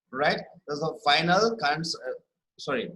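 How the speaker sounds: normal talking voice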